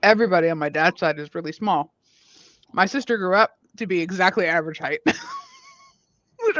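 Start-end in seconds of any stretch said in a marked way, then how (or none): 5.0-6.6 laughing. Real, not acted. Laughing remark.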